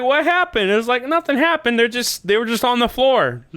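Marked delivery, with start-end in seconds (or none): 0.0-0.7 very high, baffled cry; alarmed question. Retold or imitated. Acts out question over dead cats. 1.1-3.5 high-pitched protesting; baffled protest. Retold or imitated. Acts out protest over dead cats.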